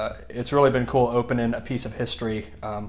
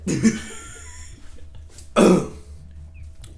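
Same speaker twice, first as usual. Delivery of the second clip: cough turning into laughter; 7 semitones higher than usual. Real, not acted.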